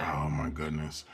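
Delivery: soft, slow, low aside; understated, drawn-out disbelief; muted exasperation. Retold or imitated. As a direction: sigh it quietly to yourself in mock dismay. Retold reaction to meeting mishap.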